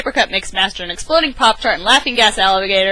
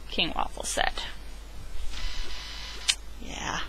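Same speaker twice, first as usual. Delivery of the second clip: ordinary delivery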